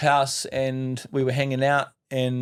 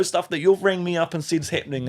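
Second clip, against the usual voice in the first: ordinary talk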